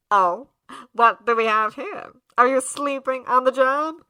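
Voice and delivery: loud, theatrical mocking greeting; Alistair, taunting. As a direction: catch someone napping and announce it loudly.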